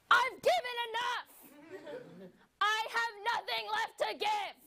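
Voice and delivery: high, strained, melodramatic lament; dramatic wail. Acted. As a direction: cry out like a martyr. Dramatic depleted reply.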